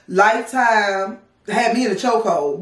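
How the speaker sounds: ordinary delivery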